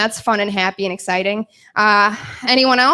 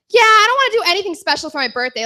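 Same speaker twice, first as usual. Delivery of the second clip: fake nonchalance; 7 semitones higher, faster than usual. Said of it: "go to my friends and be like".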